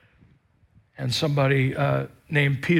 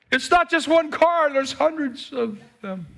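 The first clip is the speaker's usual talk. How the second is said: much higher, incredulous husband's punchline; mock incredulous husband; 10 semitones higher than usual. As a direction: squeak it out in disbelief.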